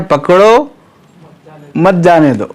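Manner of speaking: पकड़ो rising, then a break before मत जाने दो; pause after पकड़ो. Shown as a demonstration. Teacher shows students the king's intended reading, pause after पकड़ो. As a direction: split off पकड़ो, keep मत जाने दो together and falling. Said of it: "तो बादशाह की मुराद है".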